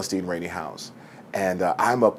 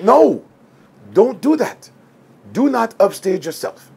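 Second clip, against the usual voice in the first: much higher and louder, cutting him off; the director putting his foot down; firm insistence; 11 semitones higher, 6 dB louder than usual. Retold or imitated.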